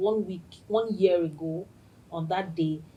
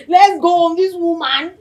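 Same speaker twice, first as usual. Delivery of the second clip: louder, higher exasperated mock outburst; imitating angry assistant; 10 semitones higher, 14 dB louder than usual. Host mimicking her assistant fuming about the late guest, loud. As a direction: snap it like a fed-up assistant. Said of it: "This is how she swear".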